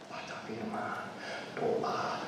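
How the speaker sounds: whispered list of devotions. Shown as a demonstration. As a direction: breathe it out in a whisper. Bisikan contoh doa.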